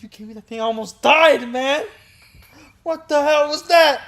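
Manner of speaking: high, loud furious complaint; angry yell. Retold or imitated. Friend yelling at grandpa about the medicine. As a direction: chew him out loudly.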